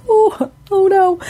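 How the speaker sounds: high, slow oh no; imitating her own dismay; mock dismay. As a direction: dread in the voice. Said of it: "I'm just like".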